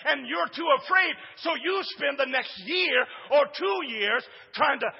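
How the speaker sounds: high, loud, driving preacher's cry; preaching at full force. Acted. Pastor preaching to his congregation, full-force shouted preaching. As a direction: build and hammer it home to the church.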